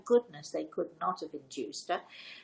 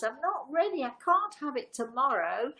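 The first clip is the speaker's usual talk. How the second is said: imitating her crying out; 7 semitones higher than usual. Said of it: "she cries".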